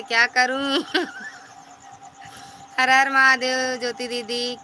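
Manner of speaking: ordinary delivery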